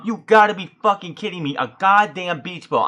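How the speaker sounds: loud, disbelieving outburst; exasperated rant. Retold or imitated. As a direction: scold the crowd, fed up. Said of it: "And I'm like".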